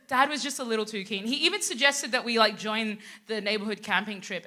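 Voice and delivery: ordinary delivery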